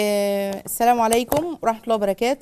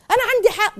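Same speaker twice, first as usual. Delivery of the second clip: high, loud indignant claim; stubborn insistence; 10 semitones higher, 5 dB louder than usual. Retold or imitated. Imitating a wife arguing with her husband, high and indignant. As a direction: insist you're right, indignantly.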